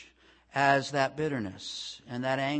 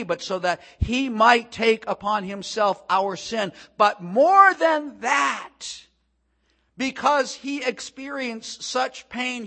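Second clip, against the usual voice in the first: loud, high, emphatic sermon delivery; full-force preaching; 8 semitones higher, 9 dB louder than usual. Real, not acted. Preacher explaining the pierced Messiah's suffering to the congregation, impassioned preaching. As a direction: drive each phrase home from the pulpit.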